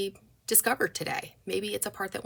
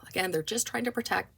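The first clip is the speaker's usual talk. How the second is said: ordinary delivery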